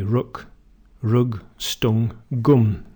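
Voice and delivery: northern English "rook, rug, stung, gum" with a rounded oo; northern English vowel oo; northern English accent. Shown as a demonstration. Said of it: "in the north".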